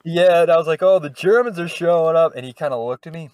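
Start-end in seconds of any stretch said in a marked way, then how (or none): 0.8-2.3 higher, louder improv announcement; mock announcement. Improviser retelling the joke to a friend, mock announcement. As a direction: call it out big, retelling the bit.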